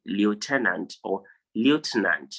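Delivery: lieutenant as lee-oh-TEN-ant, then LEE-oh-tuh-nant; common mispronunciations. Shown as a demonstration. Teacher citing what people say, lieutenant with a lee-oh start. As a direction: shift the stress in lieutenant between two wrong versions. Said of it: "people mostly say".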